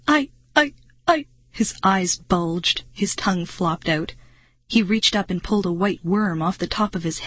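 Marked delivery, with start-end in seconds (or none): 0.1-1.3 stuttering in horror, higher; barely able to speak from fear; terrified stammer. A narrator's reading. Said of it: "He could only utter".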